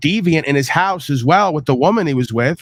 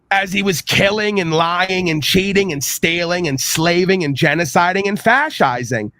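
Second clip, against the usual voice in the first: normal talking voice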